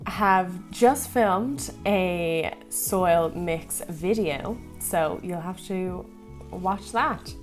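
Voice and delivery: ordinary delivery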